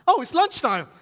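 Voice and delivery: high, loud burst of relief; acted delight. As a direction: cry it out like a longed-for announcement. Acted procrastinator delighted at lunchtime.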